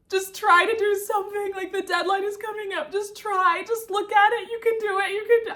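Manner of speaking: high, coaxing self-encouragement; a lightly voiced inner coach; self-pep talk. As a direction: gently push yourself to keep going. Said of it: "I'm trying to motivate myself".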